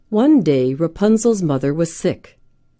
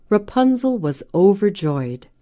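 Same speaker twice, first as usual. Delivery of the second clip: ordinary delivery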